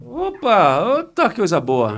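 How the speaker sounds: high, loud joyful burst; overjoyed. Retold or imitated. Believer reacting to a prophecy, loud and happy. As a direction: shout out a delighted opa.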